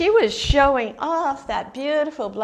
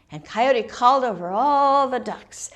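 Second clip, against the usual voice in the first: ordinary delivery, nothing marked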